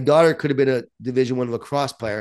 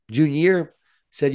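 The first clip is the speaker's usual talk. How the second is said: ordinary talk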